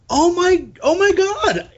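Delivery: very high, thrilled exclamation; excited realisation. Retold or imitated. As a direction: replay a sudden thrill of recognition. Recalls realising which episode it was.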